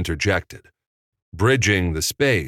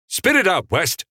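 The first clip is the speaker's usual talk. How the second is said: high, loud prodding; exasperated order to a hesitant youngster; impatient demand; 13 semitones higher, 4 dB louder than usual. A narrator's reading. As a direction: cut in sharply, pitched way up. Boss impatient with stammering youth.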